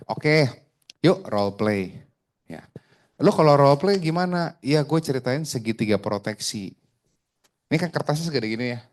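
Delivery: ordinary talk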